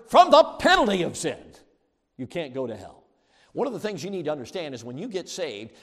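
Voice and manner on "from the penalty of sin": loud, high, emphatic pulpit shout; preaching shout. Real, not acted. Preacher driving home salvation to his congregation, shouted. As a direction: hammer the phrase home at full volume.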